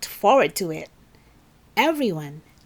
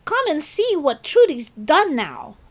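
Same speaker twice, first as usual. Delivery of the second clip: tattling call; 7 semitones higher, 4 dB louder than usual. Acted. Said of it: "he called".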